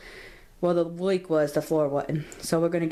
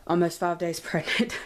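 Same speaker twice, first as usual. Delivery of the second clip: ordinary talk, nothing marked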